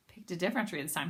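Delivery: usual speaking voice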